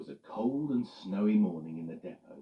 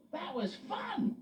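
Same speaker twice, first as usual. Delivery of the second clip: gleeful; slower than usual. Acted. Freddie voiced.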